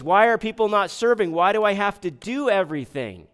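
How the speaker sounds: mock fed-up worker; exasperated complaint. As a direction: vent like an overworked volunteer. Voicing a resentful church worker.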